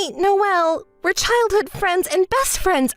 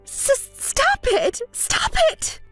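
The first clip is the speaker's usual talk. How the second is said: much higher, breathless cry; panicked protest; 10 semitones higher, slower than usual. Acted. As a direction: plead in sudden panic. Panicked protest at being cut off.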